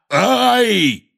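high, sudden cry of pain; pained yelp. A narrator's reading. Hagrid struck by the giant mid-scolding, yelping.

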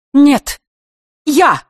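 high, loud interjection; emphatic announcement. A narrator's reading. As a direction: ring out both short words. Queen arrives, announces herself loudly.

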